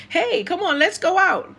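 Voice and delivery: high, eager call; excited invite. Pretend. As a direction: cheerfully invite a friend out. Imagined friend calling her out.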